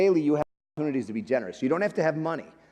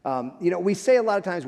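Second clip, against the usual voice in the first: usual speaking voice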